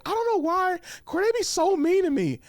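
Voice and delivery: high, whiny imitation of the girl next to him; mock girl voice. Mimicking the girl beside him, high and whiny. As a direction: squeak it out like a complaining girl. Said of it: "the chick sitting next to me, she was like".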